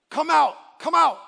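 high, loud repeated shout; shouted summons. Acted. King orders the men out of the furnace, shouted summons. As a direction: shout to them across a roaring fire.

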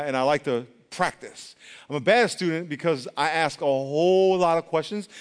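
ordinary talk, nothing marked

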